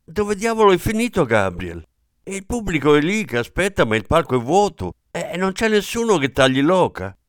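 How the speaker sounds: put-on a flustered old aunt yelling for help; loud, rushed, wringing-hands panic; frantic shouting. A narrator's reading. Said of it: "gridò".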